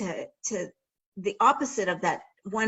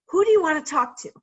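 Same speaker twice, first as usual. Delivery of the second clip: friendly call; 7 semitones higher, 7 dB louder, faster than usual. Retold or imitated. Receiver offering help, calling out.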